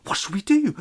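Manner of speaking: high, rising panicked question; fretful villagers. Acted. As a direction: fret helplessly, voice going up. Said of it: "they exclaimed".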